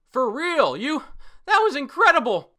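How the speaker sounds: amazed shout. Acted. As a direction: shout it up high, blown away. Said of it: "Fenrir's like".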